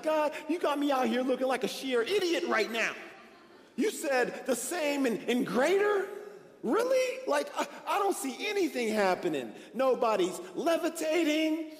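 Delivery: acted exasperated protest to God, pitched high; irritated complaint. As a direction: complain upward in mock indignation. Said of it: "I'm getting irritated".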